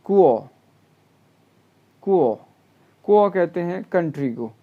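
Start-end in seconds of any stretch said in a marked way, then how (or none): 0.0-2.4 "कुओ" said louder, second tone rising; model word. Shown as a demonstration. Teacher drilling "कुओ" loudly for students. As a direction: stress "कुओ" firmly with a rising tone. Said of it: "ये pronounce होगा कुओ".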